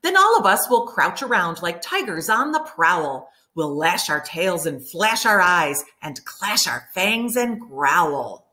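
ordinary delivery, nothing marked

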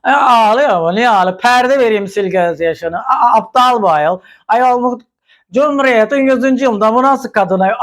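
mock drunk woman. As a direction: lampoon a tipsy TV guest scoffing at a crying girl. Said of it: "sanki sarhoş gibi kadın". (sarhoş bir kadını taklit eden, daha tiz alaycı ses)

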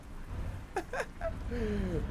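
long high giggle that tails off; high wheezy laugh. Real, not acted. Streamer amused by familiar streets, high laugh.

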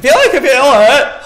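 loud, high, frantic yelp; mounting panic. Real, not acted. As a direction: cry out as if the scare is coming. Panic rising, scare expected.

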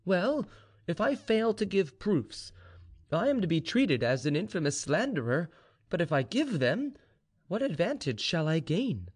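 high-voiced informer angling for reward; bargaining slave informer. A narrator's reading. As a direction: haggle respectfully with your master. Gregory the slave bargains with general.

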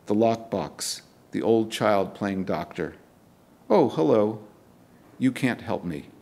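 measured, deliberate poem reading with weighted pauses; poetry reading. Acted. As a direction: read it like a spoken-word performance. Reads second sonnet.

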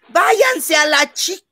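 high, slow mock yell, cut off; high shouted curse broken off; retold shout. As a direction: yell at the crowd like a scared kid. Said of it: "y grité".

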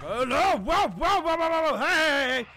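loud, rapid panicked yelps; alarmed yelling. Real, not acted. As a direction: yell out in alarm at a sudden threat. Startled by threat in game.

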